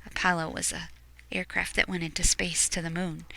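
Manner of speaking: soft whispered aside; whispered explanation. Real, not acted. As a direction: explain it in a hush.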